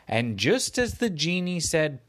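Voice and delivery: usual speaking voice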